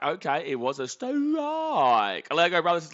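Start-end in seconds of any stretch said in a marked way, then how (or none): 0.9-2.3 stretched, ringing strike call; long showy umpire holler; drawn-out umpire call. Acted. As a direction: call it like an umpire, dragging the vowel.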